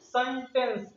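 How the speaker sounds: सेंटेंस with the C as an S sound; pronunciation demo. Shown as a demonstration. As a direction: say सेंटेंस with a soft S, not a K. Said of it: "इसको क्या पढ़ते हैं".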